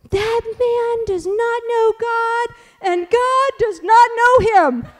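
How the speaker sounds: put-on very high, slow, grave little-boy voice; child's solemn proclamation. Mother mimics her small son's solemn judgment. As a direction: play the stern small prophet, drawn out. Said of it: "and he goes".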